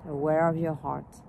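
calm meditation voice, phrases spaced by long pauses, softening as it goes; guided meditation. Real, not acted. Teacher leading a smiling heart meditation, calm and unhurried. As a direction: guide them gently into the heart, letting phrases fade.

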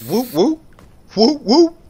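high, rising double whoops; playful whooping. Real, not acted. Player goofing around with an in-game friend, playful whooping. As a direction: whoop along playfully in short bursts.

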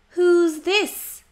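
ordinary delivery, nothing marked

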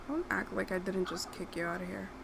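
ordinary talk, nothing marked

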